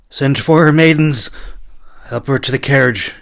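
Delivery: loud, urgent orders; commanding. Acted. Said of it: "he commanded".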